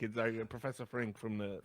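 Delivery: ordinary talk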